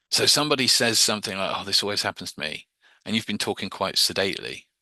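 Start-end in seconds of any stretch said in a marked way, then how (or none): 1.5-2.7 rattled off quickly; a sudden burst of speed; fast. Shown as a demonstration. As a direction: blurt it out fast. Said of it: "said really quickly".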